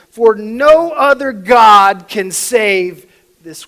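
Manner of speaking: loud, emphatic royal decree; loud scripture quote building in force; king's proclamation. Acted. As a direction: declaim it forcefully, like a king addressing his people. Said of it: "This is what he says".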